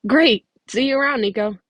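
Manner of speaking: bright, loud and high, all cheer; excited goodbye. Acted. Camper cheerfully saying bye to Nico, loud and high. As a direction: call it out happily as you leave.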